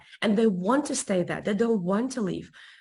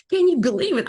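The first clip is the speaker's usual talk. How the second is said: excited outburst; 8 semitones higher, 6 dB louder than usual. Retold or imitated. Excitedly tells husband.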